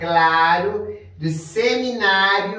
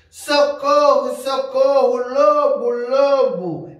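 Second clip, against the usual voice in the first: raised, higher panicked shout of "socorro"; shepherd boy screaming for help at the village; desperate cry for help; 5 semitones higher, 4 dB louder than usual. A narrator's reading. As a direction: call out like a terrified boy seeing a wolf. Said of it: "gritou o jovem desesperado".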